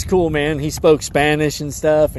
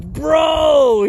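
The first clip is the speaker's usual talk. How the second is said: exasperated shout; 12 semitones higher, 4 dB louder than usual. Retold or imitated. Calling out friend who set him up.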